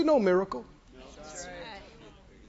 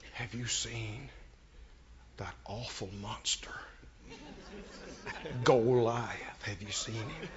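hushed scared whisper, much softer, lower; fearful whisper; 12 semitones lower, 15 dB quieter than usual. Retold or imitated. Frightened soldiers whispering to David about Goliath. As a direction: breathe it like the giant might hear. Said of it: "they said".

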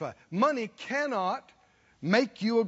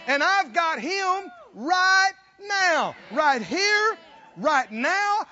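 high, loud, slow climax; shouted preaching; 6 semitones higher, 6 dB louder, much slower than usual. Acted. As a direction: shout the joy to the congregation. Preaching at full force.

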